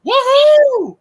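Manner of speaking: very high, loud cheer; loud celebratory holler; celebratory whoop. Real, not acted. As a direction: whoop with gleeful triumph. Celebrating the news just read out.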